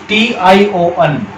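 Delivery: T I O N spelled slowly, letter by letter; spelling out slowly. Shown as a demonstration. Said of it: "ये ध्यान रखने की बात है".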